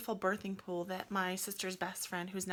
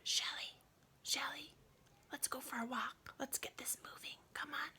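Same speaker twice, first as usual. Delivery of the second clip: higher, whispered coaxing; hushed midnight nudge to get up; whispered wake-up; 5 semitones higher, 8 dB quieter than usual. Retold or imitated. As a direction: gently rouse someone sleeping nearby. Friend waking her at night.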